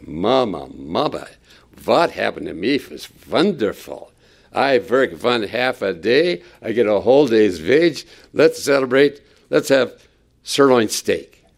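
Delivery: put-on excited Yiddish-tinged accent, v for w; a gleeful worker bragging in a Yiddish accent; mock Yiddish-flavored worker. Worker bursting in telling Mama, mock Yiddish accent.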